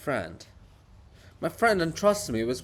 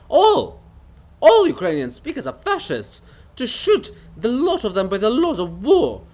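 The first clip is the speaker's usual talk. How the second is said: much higher and louder, screaming in rage; fanatical shout; 6 semitones higher, 7 dB louder than usual. Acted. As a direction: rant it out at the top of the voice. Bulgakov's fanatical outburst.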